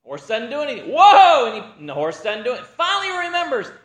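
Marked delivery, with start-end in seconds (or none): 0.9-1.5 much louder, high alarmed cry; panicked whoa. Retold or imitated. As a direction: haul the horse back with your voice. Rider tries to halt horse.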